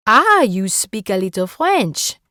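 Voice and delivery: put-on light French accent, pleased. Rosalie pleased Erin knows some French, light French accent. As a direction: sound pleasantly surprised, with a French lilt. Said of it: "in a thick French accent".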